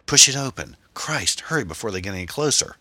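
loud, high command to hurry; urgent cry. A narrator's reading. Driver to passenger as pursuers close in, crying out urgently. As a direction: cry out urgently, hardest at the start. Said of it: "Tom cried".

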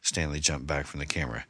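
plain reading voice

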